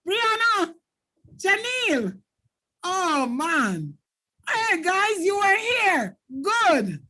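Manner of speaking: high, bright burst of joy at a name; delighted greeting. Real, not acted. Teacher thrilled to see Gabriel in the chat, excited high call. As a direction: call out to him like a long-lost friend. Teacher thanking students at class end.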